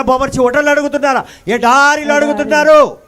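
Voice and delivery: preacher building to a cry about the desert; rising climax. Acted.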